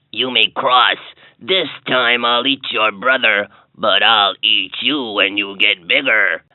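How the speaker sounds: low, slow troll growl; slow and low like a troll under a bridge; deep troll voice. A narrator's reading. Troll replies.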